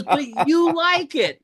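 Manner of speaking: high incredulous wife; mock accusing wife. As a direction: play her disbelief, high and drawn out.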